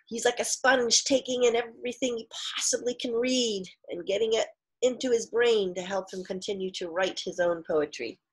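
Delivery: ordinary talk, nothing marked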